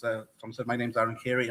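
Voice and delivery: usual speaking voice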